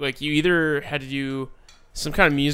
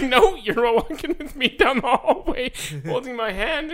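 teacher insisting; 10 semitones higher, 3 dB louder than usual. Retold or imitated.

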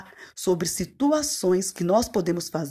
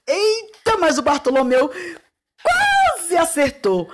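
high, loud Eita of surprise; surprised exclaim; 9 semitones higher, 3 dB louder than usual. Real, not acted. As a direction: react with cheerful astonishment. Reacting to uncle's attempt. (tia surpresa com o tio, alto e agudo)

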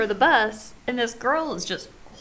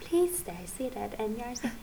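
recorded announcement; 5 dB quieter than usual. Retold or imitated. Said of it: "the bus is talking".

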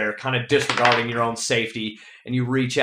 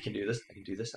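under his breath; 2 semitones lower, 13 dB quieter, much faster than usual. Acted. Game master as a scared climber to himself, under his breath. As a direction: murmur it to yourself like a nervous mantra. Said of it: "you can hear him under his breath".

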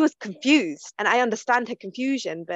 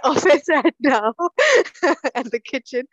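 higher, louder, breaking into laughter; laughing; 5 semitones higher, 5 dB louder than usual. Real, not acted. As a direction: tease him and laugh through the words. Teasing Manny, laughing as she talks.

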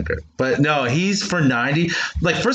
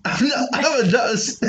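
high, hammy fake British accent; hammed-up British accent; 13 semitones higher, slower than usual. Impersonating an English coworker for the co-host, hammy British accent. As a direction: ham up a cockney-ish British voice. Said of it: "fuck up your accents".

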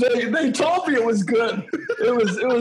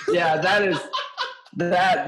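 usual speaking voice

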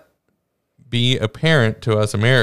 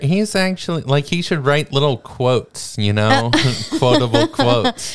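put-on northern accent; 5 semitones higher than usual. Teasing the director's quotes.

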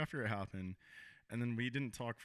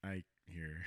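soft, low, deflated offer; dejected mumble; 6 semitones lower, 5 dB quieter than usual. Retold or imitated. Offering the ring again to his ex, soft and deflated. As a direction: let it trail off in defeat. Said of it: "and I was like".

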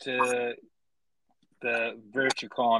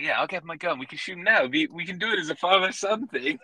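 eager; 6 semitones higher than usual. Retold or imitated. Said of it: "He's like".

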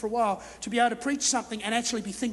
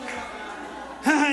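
real hearty laugh; big laugh; 3 semitones higher than usual. Real, not acted. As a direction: laugh out with relief. Laugh after triumphant shout.

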